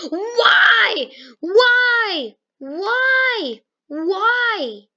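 high, loud repeated cries of why; frustrated yelling. Real, not acted. Gamer yelling at his bad luck, loud frustrated cries. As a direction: wail it out in real frustration.

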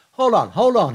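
loud, high shout over the crowd; crying out. Retold or imitated. Brown shouts at the crowd swarming the stage, loud. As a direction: shout to stop the stage rush. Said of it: "he cried".

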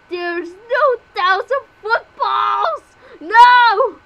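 slow, high, loud crying wail; crying shout. Pretend. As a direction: wail it out, close to tears. Upset that the footballs are gone.

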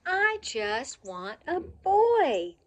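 wistful plea. Acted. Puppy insists on wanting boy.

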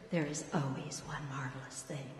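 whispered, low and soft; hushed assurance. A narrator's reading. Pat reassures doubtful Ellen, whispering. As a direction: let her in on a secret in a whisper. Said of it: "Pat assures her".

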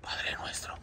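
barely audible whisper; whispered prayer. Real, not acted. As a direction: start a prayer under the breath. Praying under breath. (oración susurrada, apenas audible)

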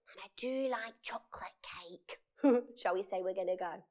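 secret whispered aside. Acted. Narrator whispers to the children, hiding it from the witch. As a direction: whisper it so the witch won't hear.

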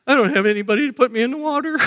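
high, hurried mock complaint; complaining excuse. Lame man answering Jesus, high hurried complaint. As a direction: rattle off the excuse in a high put-on voice.

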